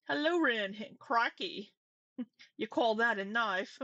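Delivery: hammed-up Aussie, breaking into laughs; horrible Australian accent. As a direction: badly fake an Australian for laughs. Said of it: "do a horrible American accent ... to pay me back for that".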